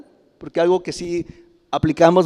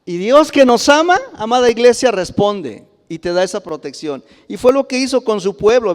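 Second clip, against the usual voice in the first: higher, louder, fervent proclamation of God's faithfulness; full-force preaching; 5 semitones higher, 5 dB louder than usual. Real, not acted. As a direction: preach it out to the congregation with rising fire. Preacher addresses the beloved church.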